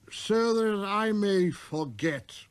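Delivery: put-on very slow, heavy drunk voice; a sad drinker drowning sorrow; mournful tippler.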